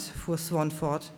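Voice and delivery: usual speaking voice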